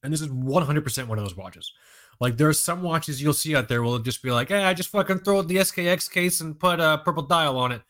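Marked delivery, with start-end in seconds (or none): 4.5-7.8 lazy drawling bro voice, a bit higher; half-ass accent. Retold or imitated. Careless watchmaker voiced in his half-ass accent. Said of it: "I always just do that accent".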